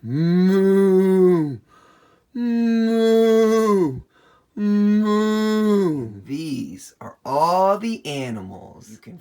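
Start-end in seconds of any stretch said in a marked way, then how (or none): 0.0-6.2 loud, high, long held moo; cow moo. Pretend. Reviewing cow sounds for kids, loud drawn-out moos. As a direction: moo like a cow, big and sustained. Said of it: "The cows say".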